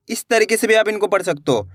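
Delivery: ordinary talk, nothing marked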